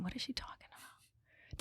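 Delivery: soft breathy whisper of confusion; whispered thought. Retold or imitated. Recalling the confusing tour guide, whispered bewildered thought. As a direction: barely voice the baffled thought.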